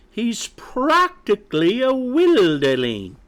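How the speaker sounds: high, outraged scolding; squawking mother hen. Acted. Mother hen orders chick home, scandalized.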